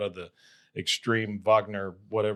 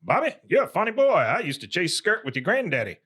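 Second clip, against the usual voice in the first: Buck; 6 semitones higher than usual. Retold or imitated. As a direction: call out heartily like a folksy boss, imitating. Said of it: "he says to Bobby".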